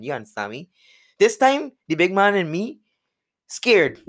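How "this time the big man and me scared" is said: high, loud, wound-up telling; excited retelling. Retold or imitated. Recalling a scary crash, high and loud excited telling. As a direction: build the tension of the moment. Retelling fear before facing driver.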